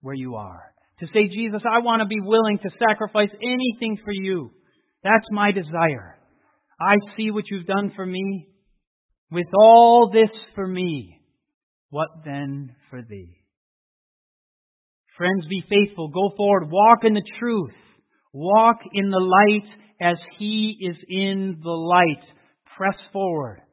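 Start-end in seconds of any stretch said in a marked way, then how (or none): 1.3-13.4 loud, rising pulpit fervour; full-throated revival preaching; preaching at full force. Acted. Leads a prayer commitment. 15.2-23.6 loud, rising pulpit fervour; full-throated revival preaching; preaching at full force. Acted. Preaching exhortation.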